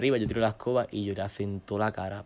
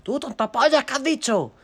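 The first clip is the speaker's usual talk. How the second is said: high, loud retold yell; angry confrontation; 6 dB louder than usual. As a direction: shout at your brother in mock outrage. Confronts brother over the rumour.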